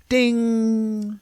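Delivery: loud, high, held mock bell; imitated timer ding. Teller imitates kitchen timer going off, ringing ding. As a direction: ring out a long ding like a timer. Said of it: "the timer in the kitchen goes off".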